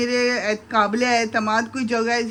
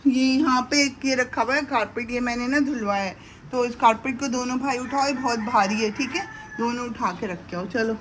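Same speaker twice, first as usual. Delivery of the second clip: ordinary talk, nothing marked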